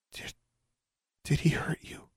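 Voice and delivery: hesitant, halting whisper. A narrator's reading. Daughter asking mother about her father, hesitant whisper. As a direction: ask it in a careful whisper. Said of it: "Alexandra whispered".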